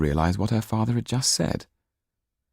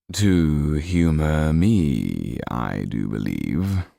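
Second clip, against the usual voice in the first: sly Count; 2 semitones lower, much slower than usual. Acted. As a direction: savour each word, amused. Count speaks to Marquis.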